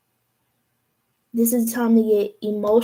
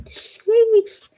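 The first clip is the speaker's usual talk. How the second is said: sheesh; 11 semitones higher, 6 dB louder than usual. Real, not acted. Reacting to the Moon card.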